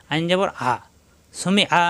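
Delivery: ordinary talk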